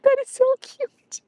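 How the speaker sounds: very high, squeaky coo; high-pitched adoring pet-talk; gushing. Real, not acted. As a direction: melt at something adorable, voice way up high. Gushing at dog's soft bark.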